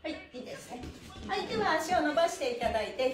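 ordinary talk

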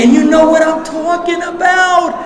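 high, loud rally to the crowd; full-force preaching. Real, not acted. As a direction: fire the room up. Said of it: "preaching to the crowd".

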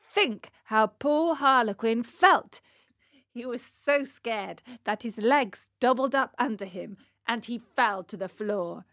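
ordinary delivery, nothing marked

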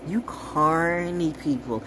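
"You carny people" stretched slow, each word drawn out. Shown as a demonstration. Warning viewers about carny people, slow and drawn out. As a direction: drag it out with contempt. Repeats phrase, second drawn out.

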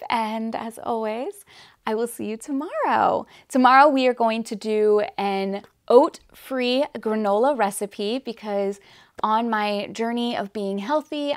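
normal talking voice

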